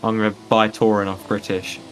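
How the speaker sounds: usual speaking voice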